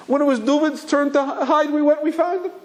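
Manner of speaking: mock child's wail, very high-pitched; crying little boy. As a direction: carry on like a tearful little boy. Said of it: "carrying on".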